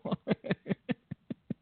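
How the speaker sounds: high wheezy laugh. Real, not acted. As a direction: let out a breathless high laugh. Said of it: "Ah, that was good".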